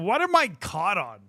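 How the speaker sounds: ordinary talk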